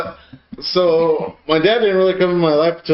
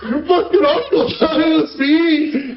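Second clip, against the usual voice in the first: laughing dad quote; 10 semitones higher than usual. Retold or imitated. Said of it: "he was like".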